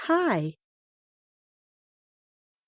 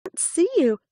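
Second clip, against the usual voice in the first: little hare voice; 10 semitones higher, faster than usual. A narrator's reading. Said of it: "said Little Hare".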